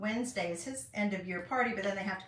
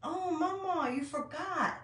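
put-on child's voice; 8 semitones higher, 3 dB louder, slower than usual. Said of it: "this morning he goes".

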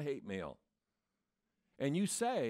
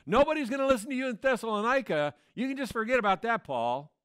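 mock discouraging naysayer, higher and louder; dismissive naysayer; 5 semitones higher, 4 dB louder, faster than usual. Doubters telling Paul to give up on his new church, dismissive. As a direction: rattle it off fast, shooting the plan down. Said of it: "those who told Paul".

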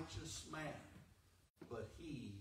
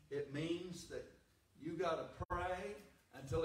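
ordinary talk, nothing marked

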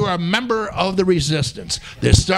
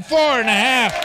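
shouted score; 5 semitones higher than usual. Acted.

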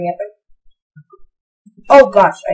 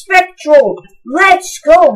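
higher, louder cheer; excited shout; 6 semitones higher, 5 dB louder, much slower than usual. Real, not acted. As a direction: celebrate the drop out loud. Thrilled by spectral arrows.

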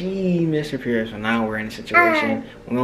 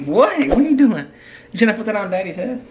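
surprised; 7 semitones higher than usual. Real, not acted. Toddler surprises him.

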